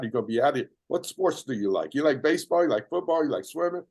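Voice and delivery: high, bubbly encouraging chatter; a sunny nurse talking to a patient; cheerful bedside talk. Pretend.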